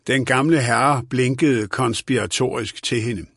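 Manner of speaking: usual speaking voice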